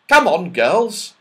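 put-on slow, loud, firm boy; firm call. Joe urging the girls to climb, slow loud firm. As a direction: urge the girls on firmly. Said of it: "said Joe firmly".

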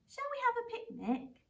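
a lightly voiced Baby Bear with an idea; eager little bear. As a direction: cub suggesting a picnic to the owl. Baby Bear's eager suggestion.

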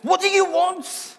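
high, loud, exasperated Italian waiter; Italian guy. Retold or imitated. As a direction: shout it up high like a fed-up Italian waiter who can't understand you. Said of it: "imagine an Italian guy".

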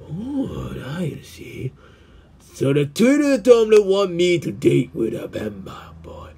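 normal talking voice

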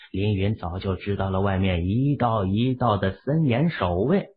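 ordinary delivery, nothing marked

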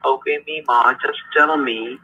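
ordinary talk

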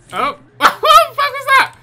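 very high, loud laughing exclamation; startled laughing shriek. Real, not acted. Player startled by a glitch, laughing shriek. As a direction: shriek it through a burst of laughter.

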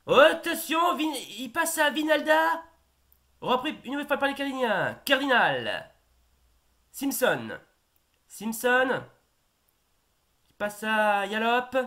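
high, loud live play-by-play; excited sports commentary. Acted. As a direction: follow the attack like a TV commentator. Commentates an attack.

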